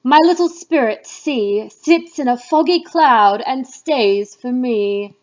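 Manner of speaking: loud, scolding dramatic reading; angry witch goddess. Acted. Witch queen scolding her witches for meddling, loud and stern. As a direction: rail at the witches as their furious queen. Speech continues after the music.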